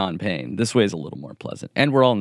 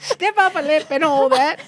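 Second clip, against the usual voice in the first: high, hammed-up British cockney voice; mock British accent. Friends riffing on the British accent, high cockney hamming. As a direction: play a cartoon British flower seller, high and hammy. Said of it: "Was that a British accent?".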